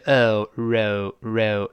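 British "row" as reh-oo, drawn out; slow British "row", the vowel split in two; British diphthong. Shown as a demonstration. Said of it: "这更难读了".

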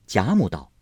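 ordinary delivery

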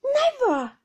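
high, loud outburst; loud, high-pitched vow; emphatic cry. A narrator's reading. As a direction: cry it out in flat refusal. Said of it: "cried Peggotty".

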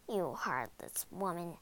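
low, soft mutter. A narrator's reading. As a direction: mutter the reproach under your breath. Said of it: "muttered the woodcutter".

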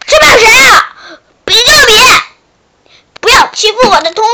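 high, loud challenge; defiant shout. Acted. Frog defiantly defending his deskmate from shark. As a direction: stand up to the bully. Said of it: "但是小青蛙说".

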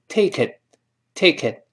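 "take it" linked, tongue stopping the final t; unreleased final t. Shown as a demonstration. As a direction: say "take it" as "tay-ki(t)", t held back. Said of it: "最后那个t失去爆破".

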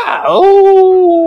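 a high, loud wolf howl. A narrator's reading. A wolf howls from the woods, ear-splitting and loud. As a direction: let rip a howl like a wolf. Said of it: "an ear-splitting howl".

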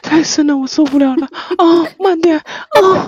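hammed-up pleas rising to a drawn-out end; mock seductive moaning. Streamer finishing a forfeit for co-hosts, mock seductive moaning. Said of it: "我骚，我骚".

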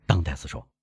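plain reading voice

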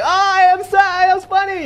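very high, loud squeal; mock amused reaction. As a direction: exaggerate a listener squealing with amusement. Acts out amused audience reaction.

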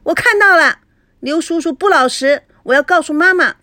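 put-on high, loud tattling child; a little girl shouting to tell on him; child catching an adult out, loud and high; child calling out. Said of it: "菲菲叫道".

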